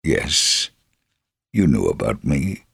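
ordinary talk

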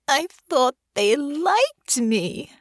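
high, slow tearful moan; crying lady moaning in bed. A narrator's reading. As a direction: let it wail out slowly through tears. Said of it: "she moaned".

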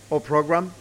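usual speaking voice

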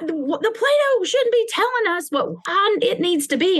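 high, childish mock outrage; kids' indignant protest. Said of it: "And they're like".

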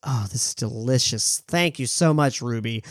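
a gracious lady sipping her tea; Helen Mirren. Acted. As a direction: Helen Mirren thanking Ruby, low and soft. Helen Mirren thanks Ruby for tea.

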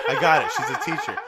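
loud, high, sarcastic outburst; high-pitched sarcastic realization; mock exasperation. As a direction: shout it back mockingly at the clumsy script. Mocks clumsy exposition in a film.

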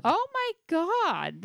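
high, slow squealed "oh my God"; shrieking. Real, not acted. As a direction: squeal it out in horror. Shrieking reaction to creepy tale.

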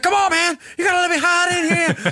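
high-pitched pleading hustler impression; Floyd Burney. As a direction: wheedle in a put-on rockabilly boy voice, way up high. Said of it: "he's all fast talking".

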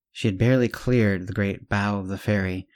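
plain reading voice

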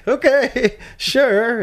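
high, laughing "Okay, sure"; laughing agreement. Retold or imitated. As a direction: laugh through the yes. Laughing acceptance of beer.

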